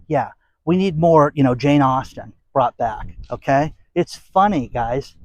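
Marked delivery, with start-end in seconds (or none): none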